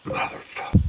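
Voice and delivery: unvoiced muttered swear under the breath; real whispered swear, off to the side; whispered curse. Real, not acted. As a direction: hiss a curse to yourself. Swears after phone call interrupts.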